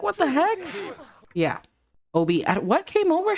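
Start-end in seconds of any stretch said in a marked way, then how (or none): 0.0-0.6 high, incredulous mock outrage. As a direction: act out an affronted outburst, pitched way up. Voicing outraged father after drink thrown.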